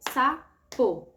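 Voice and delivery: sapo as two beats, SA... PO; syllable by syllable. Shown as a demonstration. Teacher showing the palm-clap method, sapo broken syllable by syllable. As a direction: say "sapo" slowly, one separate syllable per clap. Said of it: "você pode usar as palmas também".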